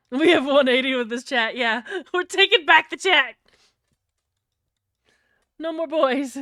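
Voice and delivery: loud, high, laughing battle cry; mock rallying shout. Streamer rallying her chat against the boys, laughing shout. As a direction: shout it like a gleeful takeover. Jokingly declares a chat takeover.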